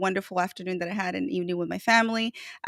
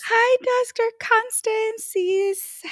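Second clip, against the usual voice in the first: high, slow, delighted hello; excited greeting; 14 semitones higher, 3 dB louder, much slower than usual. Real, not acted. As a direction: sing out a welcome to Doctor Constance.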